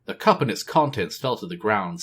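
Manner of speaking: plain reading voice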